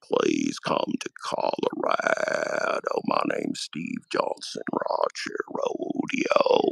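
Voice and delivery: very low, slow, croaking voice; Steve Johnson impression. Mock podcaster's final plug, very low and slow. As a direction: drag it out deep and slow like a dying drawl. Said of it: "that was my Steve Johnson impression".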